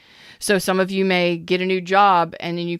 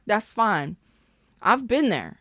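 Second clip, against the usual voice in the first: usual speaking voice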